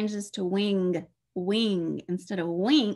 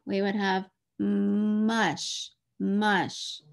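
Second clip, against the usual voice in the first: "mush" drawn out very slowly; slow sounding out; much slower than usual. Shown as a demonstration. Teacher slowly stretching "mush" for a reader. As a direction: stretch "mush" out for the child to hear. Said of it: "if we changed it to this letter".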